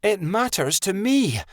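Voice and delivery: lightly barked reply. A narrator's reading. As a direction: snap it back, a touch sharp. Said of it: "Midnight Star barked".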